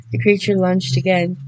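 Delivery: ordinary delivery, nothing marked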